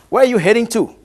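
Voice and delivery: normal talking voice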